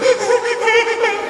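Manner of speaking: loud, high mock hyperventilating gasps. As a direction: imitate gasping through a crying fit. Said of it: "And I'm like, hyperventilating".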